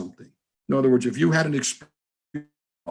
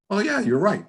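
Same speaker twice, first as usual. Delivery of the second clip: sudden realisation; 9 semitones higher than usual. Retold or imitated. Woman realising in retold talk.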